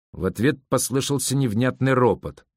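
ordinary delivery, nothing marked

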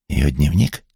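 mildly startled question, rising at the end; surprised echo question. A narrator's reading. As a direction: query it back, taken aback. Startled echo of unexpected news.

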